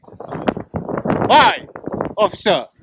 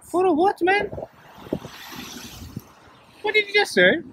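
high, incredulous what did you say; shocked disbelief; 10 semitones higher, 5 dB quieter than usual. Real, not acted. Auditor stunned at officer's radio call, incredulous and high. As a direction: voice jumps up in outraged disbelief.

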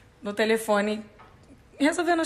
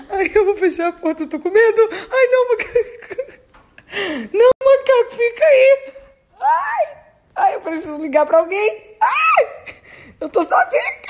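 high, loud, squealing fear mixed with laughter; laugh-cry in real panic; scared and giggling at once; panicked laughing fright; 8 semitones higher, 3 dB louder than usual. Real, not acted. Alone, scared of monkeys.

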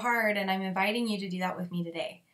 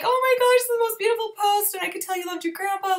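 much higher, louder delighted gush; retold gushing; 10 semitones higher, 5 dB louder than usual. Reading a friend's post about her grandpa, high delighted gush. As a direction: gush over it like it moved you. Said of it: "and I was like".

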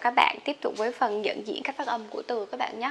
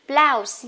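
"Blouse" said loud and slow with a clear B L onset; emphasized model word; 3 semitones higher, 8 dB louder than usual. Shown as a demonstration. Teacher drilling the class, a loud slow "Blouse". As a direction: lean hard on "Blouse" and drag it out. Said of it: "B L nhá".

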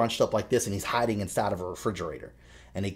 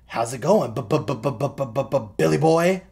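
raised taunt with machine-gun stuttered b's; mocking stutter; 5 semitones higher, 6 dB louder than usual. Clown to grown-up Billy, mocking his childhood stutter. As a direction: stutter hard, then hit the name high and loud. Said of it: "it mocks him by saying".

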